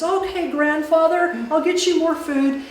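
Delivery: high, obliging singsong call; sweetly playing along. Sister humours the hungry Chenoo, high obliging call.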